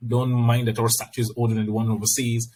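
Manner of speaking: ordinary delivery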